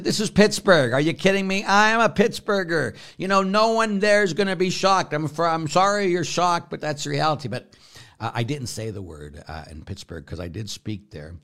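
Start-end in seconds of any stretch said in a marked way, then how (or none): 0.0-7.5 higher and louder, exasperated retort; loud mock indignation at the caller; retold indignant outburst. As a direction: fire it back, incredulous and proud. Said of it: "I said".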